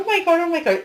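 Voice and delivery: high, loud flustered gasping; panicky exclamation. Retold or imitated. Medium imitating a flustered grandmother in a panic, high and loud. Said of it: "your grandmother would go".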